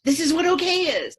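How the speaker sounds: euphoric exclamation. Retold or imitated. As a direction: marvel at the feeling as the voice climbs. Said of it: "And I was like".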